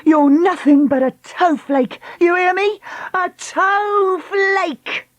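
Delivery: high, slow gloating shout; triumphant jeer. Acted. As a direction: gloat over each word. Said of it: "Lucy cried triumphantly".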